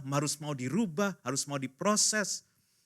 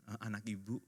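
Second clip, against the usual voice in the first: soft, lowered sheepish admission; embarrassed; 4 semitones lower, 11 dB quieter than usual. Acted. Teacher admitting it's the coordinator's child, embarrassed. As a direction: confess it sheepishly, barely speaking up. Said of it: "dengan malu gurunya".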